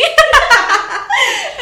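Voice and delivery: long, high, loud laugh; real burst of giggling. Real, not acted. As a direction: crack up at your own joke. Laughing at own joke.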